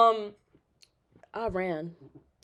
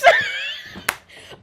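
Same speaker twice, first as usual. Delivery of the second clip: high squealing laugh; 5 dB louder than usual. Real, not acted. Nervous laughter.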